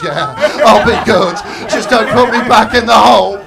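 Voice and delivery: loud, pleading, through laughter; loud, laughing mock plea from a scared engine; begging engine. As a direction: beg not to go back in the dark. Frightened engine begging after punishment.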